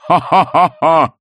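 slow, loud mocking laugh; villain laugh. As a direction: laugh him to scorn.

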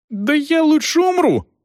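very high, anguished wail; crying out. A narrator's reading. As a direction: wail it out high in dramatic despair. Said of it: "возопил Речь".

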